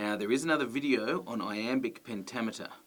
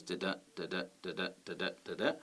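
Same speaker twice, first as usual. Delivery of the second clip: low, soft "da-da" five times, each da-DA; iambic rhythm; 3 semitones lower, 7 dB quieter, faster than usual. Shown as a demonstration. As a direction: chant "da-da" evenly, weak then stressed. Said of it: "iambic pentameter line is ten syllables".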